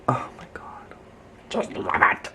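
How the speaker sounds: breathy whisper of awe, nearly silent. Real, not acted. Admiring a prized plant, hushed adoring whisper. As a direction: hush your voice in adoration of the plant.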